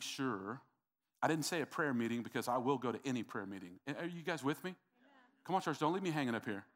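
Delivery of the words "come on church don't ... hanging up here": ordinary delivery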